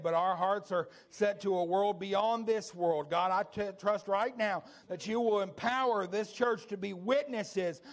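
loud, high preaching prayer; altar-call prayer at full voice; fervent prayer. Acted. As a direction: pray over the church with full force. Leads closing prayer at full force.

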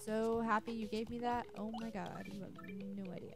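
normal talking voice

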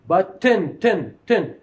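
button with a full vowel, tin; vowel pronounced. Shown as a demonstration. Teacher demonstrates a full vowel before the n. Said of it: "I pronounced the vowel".

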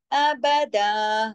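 slow recited अबदा, daa held; alif-length ending. Shown as a demonstration. Teacher shows the pausal form, अबदा drawn out. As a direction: stretch the final daa of अबदा. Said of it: "एक अलिफ की तरह पढ़ा जाता है".

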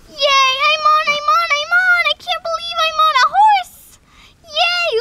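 high, loud squealing delight; thrilled squeal. Pretend. Doll rider squeals to her friend, over the moon. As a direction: squeal with joy at finally riding.

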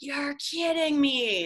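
high-pitched, drawn-out exasperation; frustrated. Retold or imitated. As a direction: stretch it out in disbelief, retelling your frustration. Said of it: "I'm so frustrated".

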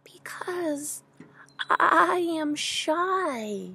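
high, slow, timid trembling. A narrator's reading. Shy creature answers from the hole, trembling. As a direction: draw it out shyly, starting near a whisper. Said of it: "Then a trembling voice says".

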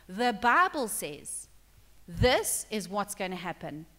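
slow deliberate quote. Acted. Jesus quoting scripture slowly.

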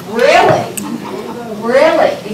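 loud, disbelieving double take; mock shock, hitting each really hard; incredulous. Retelling neighbors' reaction, a loud incredulous really.

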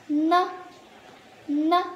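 Nơ kept light and weightless; light toneless ne. Shown as a demonstration. Teacher showing students the toneless ne in Nǐ ne. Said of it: "Đọc nhẹ thôi nhé vì không có thanh".

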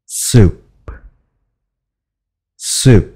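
Soup with lips pressed together at the end; bậm môi Soup. Shown as a demonstration. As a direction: close the lips firmly on Soup. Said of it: "các bạn bậm môi lại".

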